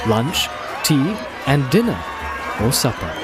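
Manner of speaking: plain reading voice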